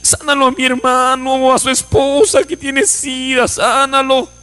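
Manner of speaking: retold weeping plea, stretched words; tearful pleading prayer. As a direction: imitate a brother crying out to God. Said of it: "el hermano lloraba y decía".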